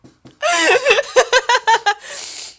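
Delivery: high, loud squealing laughter; helpless high-pitched cackle; real laughing fit. Real, not acted. Streamer losing it at viewers' jokes, laughing hard. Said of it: "The way I'm laughing now".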